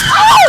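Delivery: very high piercing shriek; panicked scream. Acted. Friends in the car at the moment of the crash, screaming.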